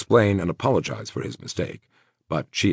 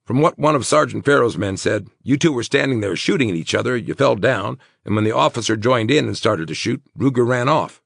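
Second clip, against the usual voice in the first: higher, animated account; Terry, explaining; 7 semitones higher, 4 dB louder than usual. Acted. Friend telling the wounded man what officers saw, raised and animated. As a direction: fill a friend in on events.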